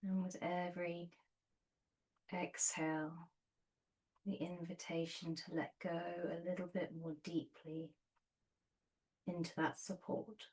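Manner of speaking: soft, unhurried meditation voice with long pauses; guided meditation. Real, not acted. Yoga teacher settling students onto their bolsters, soft guided meditation.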